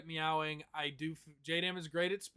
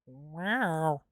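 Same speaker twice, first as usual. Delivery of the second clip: long put-on meow; cat meow; 3 dB louder than usual. Cat answering owner, long drawn meow. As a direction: meow back stubbornly. Voicing the cat's reply.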